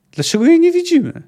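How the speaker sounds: very high, loud alarmed question; anxious cry. A narrator's reading. Boreas puzzled in the fog, anxious high cry. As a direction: voice rising in sudden unease.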